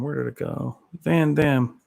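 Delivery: ordinary talk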